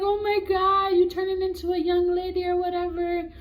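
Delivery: high, loud mock wail; imitated crying grandma. Grandma sees her turning into a young lady, mock crying. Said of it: "she's over there crying".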